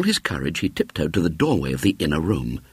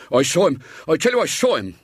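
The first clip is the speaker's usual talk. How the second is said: insistent stout man; 9 semitones higher, 3 dB louder, faster than usual. A narrator's reading.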